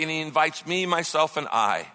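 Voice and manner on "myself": ordinary talk, nothing marked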